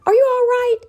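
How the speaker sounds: high, loud worried question; worried call. Acted. Classmates calling out worried to Sam. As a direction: rush up anxious.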